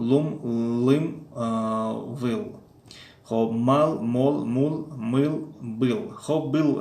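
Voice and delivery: slow syllables لا, لو and لی, each with a clear, deliberate ل; Russian L drill. Shown as a demonstration. Teacher training viewers' tongues on the Russian ل, slow and deliberate. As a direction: drill the ل sound one syllable at a time. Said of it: "تلفظ ل باید که زبانی ما و شما عادت کند".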